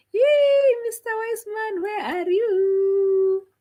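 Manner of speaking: high, stretched call across the room. Real, not acted. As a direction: sing out the call to the guest, slowly. Calling out to guest.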